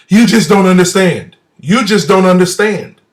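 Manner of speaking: loud, pressing repeat of the line; insistent retort. Retold or imitated. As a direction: push the words back at him, insisting. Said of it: "is what came out her mouth next".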